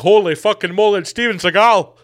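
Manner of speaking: the reporter loses composure and shouts; stunned reporter yelling. Pretend. Reporter sees Seagal emerge.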